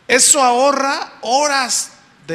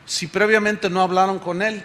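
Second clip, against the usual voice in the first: normal talking voice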